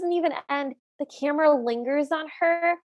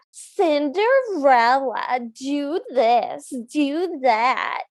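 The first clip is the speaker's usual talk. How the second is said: drawn-out, mock-commanding name and orders; mock a bossy stepmother, slowly stretching the name; mimicking the stepmother; much slower than usual. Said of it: "mimic some of her mannerisms".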